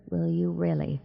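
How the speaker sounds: soft, hushed inner voice; inward thought. A narrator's reading. Sandry silently doubting her captors, inward thought. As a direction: think it softly to yourself. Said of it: "she thought".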